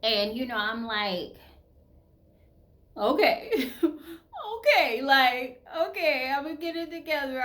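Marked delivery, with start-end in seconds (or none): 3.0-7.4 high, half-laughing near-cry; mock-tearful pep talk. Woman retelling her pep talks when down, half-tearful. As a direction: talk yourself round, voice pitched up. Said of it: "I'm not a crier".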